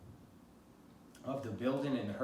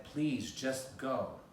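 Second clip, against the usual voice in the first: ordinary delivery, nothing marked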